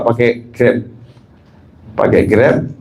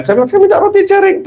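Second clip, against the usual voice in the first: mock plea. Quoting himself asking for food.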